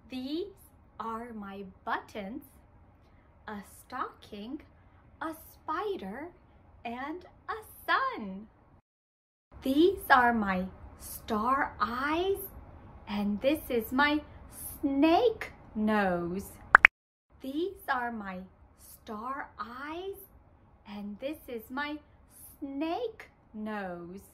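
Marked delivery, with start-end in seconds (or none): 0.1-8.5 put-on much softer, letting children lead; quieter. Shown as a demonstration. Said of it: "let's say it together". 17.4-24.2 put-on much softer, children leading; quieter. Shown as a demonstration. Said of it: "let's say it together".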